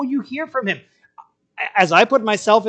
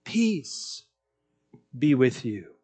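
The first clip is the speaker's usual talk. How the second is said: much softer, low and hushed; soft blessing; 8 semitones lower, 8 dB quieter, much slower than usual. Retold or imitated. Jesus stepping into our shame, speaking peace softly. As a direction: breathe the blessing gently over them. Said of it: "and he says".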